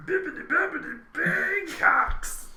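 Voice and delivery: mock the oaf's babble, high and silly; the GM echoing the oaf's babble for a laugh. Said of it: "my babble sounds like actual things".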